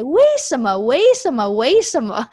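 fond, cute Western-accented 为什么 with mock surprise; surprised Westerner accent. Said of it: "when they have a very surprised tone".

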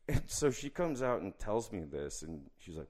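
usual speaking voice

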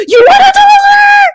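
very high, loud offer; excited yell. Pretend. Podcaster pretending to offer a dollar, loud high yell. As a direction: shout it gleefully. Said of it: "I'm like".

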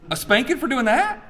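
high, incredulous question; mock disbelief. Dad answering his son's guess at punishment, incredulous. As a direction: scoff in exaggerated astonishment, pitch leaping.

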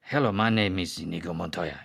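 a hammed-up bad Spanish accent, slightly low and soft; mock Spanish accent, deliberately clumsy. Quoting Inigo Montoya to friends in a bad Spanish accent. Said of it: "in a very bad Spanish accent".

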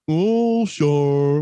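mock tipsy voice, starting high and dropping; wife's drunk-sounding voice. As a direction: imitate a wife's botched character voice. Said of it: "you sound drunk right now".

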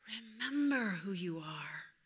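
tender whispered reminder. Acted. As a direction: speak it into the heart. God's voice in heart.

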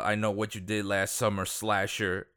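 ordinary talk, nothing marked

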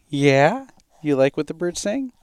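cooing question to an infant. Real, not acted. Talking to baby.

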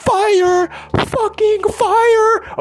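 slow, loud, soaring brag; hyped self-belief. Retold or imitated. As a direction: boast like you just stepped into your power. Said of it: "And I'm like".

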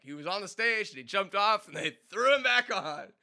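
high, loud dad retelling, slowing at the end; imitating proud dad. Dad telling everybody the story, loud, high and gleeful. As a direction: ham up dad bragging to friends. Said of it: "He loved telling everybody the story".

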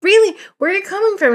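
high, bright mock surprise; imitating surprised salesman. Salesman reacting to where she flew in from, high mock surprise. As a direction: pitch way up like he's delighted to hear it.

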